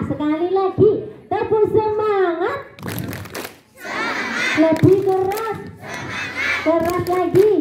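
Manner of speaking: high call to the crowd; rallying cheer. Shown as a demonstration. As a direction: rouse the audience, calling high. (seruan penyemangat yang tinggi)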